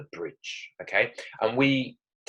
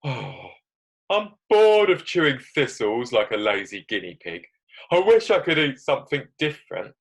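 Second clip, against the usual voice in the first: whiny complaint, loud and raised after a sigh; grumbling young goat; 4 semitones higher, 6 dB louder than usual.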